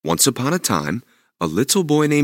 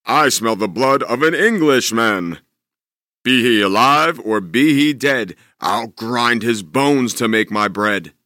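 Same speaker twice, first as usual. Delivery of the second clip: louder, slow booming giant; menacing giant; 4 dB louder than usual. Acted. Giant sniffing for Jack, booming threat. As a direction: threaten like a hungry ogre.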